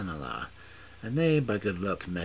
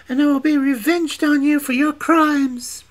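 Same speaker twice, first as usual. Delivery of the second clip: much higher, louder vow of revenge; vengeful witch; 14 dB louder than usual. A narrator's reading. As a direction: swear revenge high and loud.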